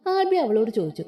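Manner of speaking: usual speaking voice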